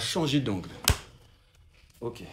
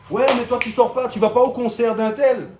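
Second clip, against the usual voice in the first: pushy pal voice, pitched up; teasing buddy; 7 semitones higher, 4 dB louder than usual. Retold or imitated. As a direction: needle him like a mate. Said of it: "il y a lui qui va venir par là te dire". (voix de copain insistant)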